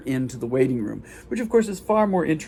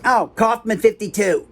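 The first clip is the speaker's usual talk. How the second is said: higher-pitched imitation of a receptionist; brusque receptionist; 8 semitones higher than usual. Receptionist sends a visitor onward, high brusque imitation. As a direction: pitch it up and toss out the directions. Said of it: "lady that had growled at me".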